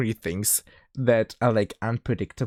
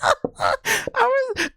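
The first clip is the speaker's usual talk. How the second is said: laughing. Real, not acted. Laughing hard.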